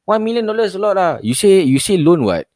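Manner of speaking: scam caller voice. Retold or imitated. Caller haggling loan amounts.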